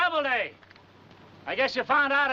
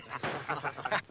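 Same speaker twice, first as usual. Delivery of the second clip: mocking laugh; 8 semitones lower, 8 dB quieter than usual. Real, not acted. Mocking the scared gunner.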